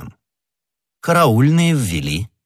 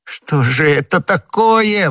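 loud, high, drawn-out wail; despairing exclamation; 11 semitones higher, 5 dB louder, slower than usual. A narrator's reading. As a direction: wail it slowly in utter despair. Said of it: "воскликнул галантерейщик в полном отчаянии".

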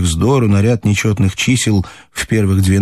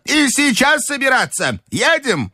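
very high imperious demand; grandmother's cry. A narrator's reading. Grandmother demanding tea on arriving home, high cry. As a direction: cry out the order in a high old-lady voice. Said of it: "закричала бабушка".

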